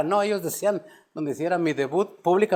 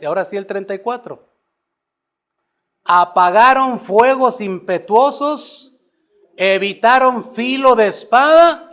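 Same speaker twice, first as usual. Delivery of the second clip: loud, swelling preacher's cadence, drawn-out list; fiery pulpit delivery, louder and slower on the heroes; full-force preaching; 5 semitones higher, 8 dB louder, slower than usual. Acted. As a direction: preach it to the back row, hammering each name. Preaching Hebrews eleven aloud.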